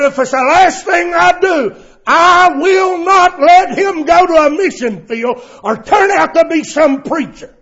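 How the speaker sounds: loud, high, furious outburst; enraged mother. Retold or imitated. Mother enraged at her son, furious high shout. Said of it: "his mother became enraged".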